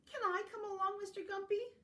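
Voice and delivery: small eager high rabbit voice; storybook rabbit. Acted. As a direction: ask in a high bunny voice. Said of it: "said the rabbit".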